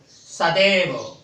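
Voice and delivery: सदैव said slowly, syllable by syllable; slowly spelled out. Shown as a demonstration. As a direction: stretch out सदैव for the class. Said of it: "देखा, जैसे सदैव". (सदैव धीरे-धीरे, अक्षर-अक्षर)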